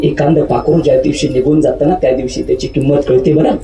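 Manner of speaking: low, earnest prayer to God. Acted. (देवाकडे खालच्या आवाजात कळकळीची प्रार्थना)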